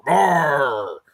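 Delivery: playful drawn-out growly roar; mock roar. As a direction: let out a mock beast roar. Mimics a roar after reading comment.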